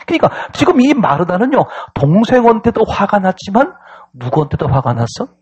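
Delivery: ordinary talk, nothing marked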